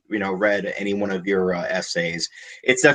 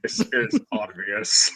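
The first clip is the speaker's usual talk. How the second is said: slow alien monster voice; Doctor Who alien voice; 4 dB quieter, slower than usual. Pretend. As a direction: intone it like a sci-fi creature. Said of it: "I love that quote from Doctor Who".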